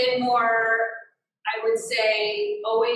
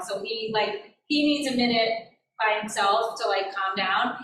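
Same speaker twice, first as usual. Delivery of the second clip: ordinary talk, nothing marked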